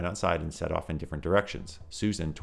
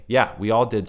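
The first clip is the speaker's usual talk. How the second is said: dismissive; 5 semitones higher, 10 dB louder than usual. Acted. Susan cutting Tom off.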